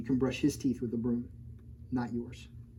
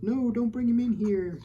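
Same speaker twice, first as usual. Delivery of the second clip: higher, put-on alarmed teacher voice; fourth grade teacher; 9 semitones higher, 3 dB louder than usual. Teacher refusing the pterodactyl in class, mock alarmed. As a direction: play the teacher shooing the pet out. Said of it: "She's like".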